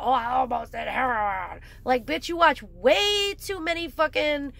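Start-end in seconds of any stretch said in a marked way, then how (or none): 0.0-1.6 mocking quote. Mocking a poser who brags about almost doing heroin, lightly voiced. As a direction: mimic a poser casually bragging. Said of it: "you're talking about".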